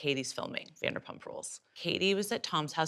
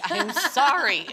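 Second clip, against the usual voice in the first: slow, high, loud laughing apology; laughing hammed-up apology; 9 semitones higher, 7 dB louder, slower than usual. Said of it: "Here's my public apology".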